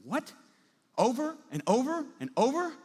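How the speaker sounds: high, loud incredulous question, each "over" swooping up; jaw-dropped disbelief. Acted. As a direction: repeat it back as if stunned. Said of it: "And jaw drops".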